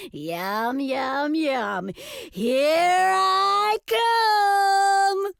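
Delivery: greedy chant rising to a long, high call; gleeful wolf. A narrator's reading. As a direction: relish it, then stretch the last words out high. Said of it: "laughed the wolf".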